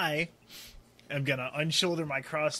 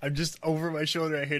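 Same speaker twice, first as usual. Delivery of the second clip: normal talking voice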